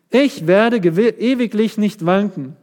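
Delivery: boastful. Acted. Preacher voices David's boast.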